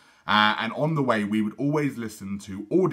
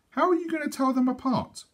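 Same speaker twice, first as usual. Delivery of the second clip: put-on high little girl asking.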